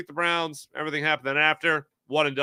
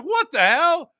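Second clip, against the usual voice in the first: baffled; 6 dB louder, slower than usual. Retold or imitated. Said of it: "So he's like".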